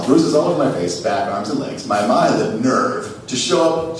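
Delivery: ordinary talk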